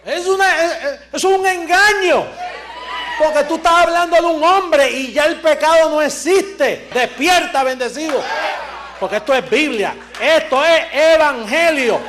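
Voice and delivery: preacher rousing the faithful, loud and fervent; full-force preaching. Acted. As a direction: drive it home from the pulpit.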